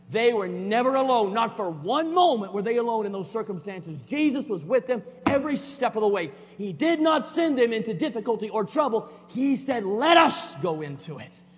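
loud, high, driving preacher voice; preaching at full force. Acted. Pastor driving the point home to church, loud preaching. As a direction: pound it out from the pulpit.

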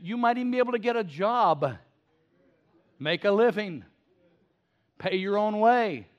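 loud, high, driving sermon climax; full-force preaching. Acted. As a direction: preach it out, building to the rafters.

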